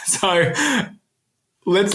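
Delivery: giggling it off after a joke; laughing. Real, not acted. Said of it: "That's my Australian accent".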